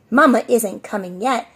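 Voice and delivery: ordinary delivery, nothing marked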